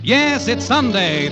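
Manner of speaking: usual speaking voice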